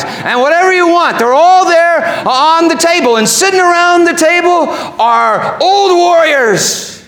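high, ringing sermon voice; full-force preaching. Acted. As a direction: preach it high and intense, painting a grand banquet.

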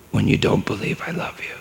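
put-on tender pleading whisper to a child. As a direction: breathe it to her, barely voiced. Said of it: "father pleading to daughter".

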